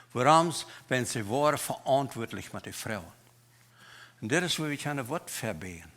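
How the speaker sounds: normal talking voice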